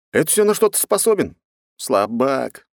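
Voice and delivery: high-pitched scornful challenge; goading taunt. A narrator's reading. Hero deliberately provoking the pirate captain, taunting. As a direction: needle him to make him lose his temper. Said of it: "Нарочно вывожу его из себя".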